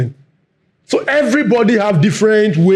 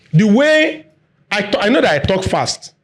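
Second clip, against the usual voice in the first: normal talking voice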